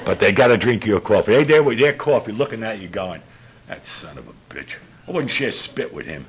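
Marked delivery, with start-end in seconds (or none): 3.7-6.2 resentful mutter. Retold or imitated. Coffee drinkers muttering resentfully.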